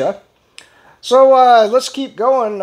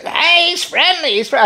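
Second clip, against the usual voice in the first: high, breezy imitation of the dog owner; mock woman's voice; 7 semitones higher than usual. Dog owner brushing off the worried hiker, high mock voice. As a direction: lightly mimic a carefree dog owner. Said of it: "And she goes".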